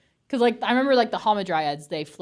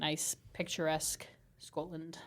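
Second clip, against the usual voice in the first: put-on Scottish accent; 11 dB quieter than usual. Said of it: "I can't really do a Scottish accent".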